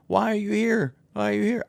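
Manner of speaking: high, put-on kid voice, questioning; squeaky imitation of a protester kid; mock kid voice. Parent mimics kid asked on TV why there.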